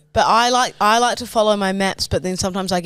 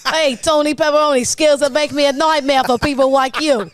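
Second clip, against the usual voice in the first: higher, hammed-up mobster with muddy consonants; impeded gangster impression; 5 semitones higher than usual. Mobster warning a rival, high and thick-tongued. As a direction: run the threat together, thick-tongued. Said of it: "you just have a coinciding speech impediment".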